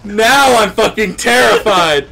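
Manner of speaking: high panicked screaming; scared horror game streamer. Real, not acted. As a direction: shriek in terrified panic, laughing. Monster chase in horror game.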